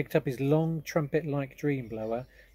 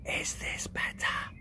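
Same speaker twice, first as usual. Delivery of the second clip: put-on girl speaking under her breath to the giant; slower than usual. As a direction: breathe the words barely aloud. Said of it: "speaking right under her breath".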